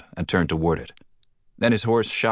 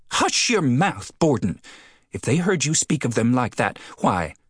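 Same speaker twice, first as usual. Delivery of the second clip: scandalized scolding; 7 semitones higher than usual. Acted. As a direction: hush him, shocked. Postmistress shocked at him.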